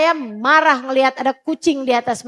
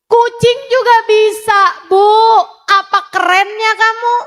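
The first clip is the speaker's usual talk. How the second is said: higher and louder, scornful challenge; raised rebuke; 6 semitones higher, 3 dB louder than usual. Real, not acted. Preacher challenging the mothers who only scold, high and loud. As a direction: throw the question at them with scorn.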